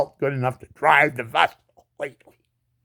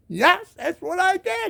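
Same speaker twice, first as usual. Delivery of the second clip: raised voice; slower than usual. Retold or imitated. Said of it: "I said".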